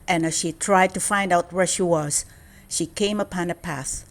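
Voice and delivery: ordinary delivery, nothing marked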